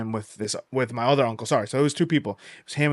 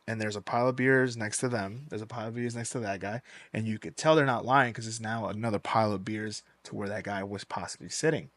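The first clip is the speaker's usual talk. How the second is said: ordinary delivery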